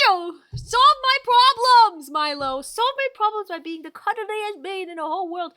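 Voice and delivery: mock pleading squeal. Real, not acted. Streamer playfully begging her dog to cuddle, high squeal.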